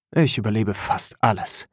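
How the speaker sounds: plain reading voice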